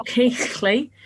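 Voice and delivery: Keighley drawn out with a throaty gh; old guttural pronunciation. Shown as a demonstration. Host demonstrates old English, Keighley with exaggerated gh. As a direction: stretch Keighley slowly round a rough gh sound. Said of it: "something like that".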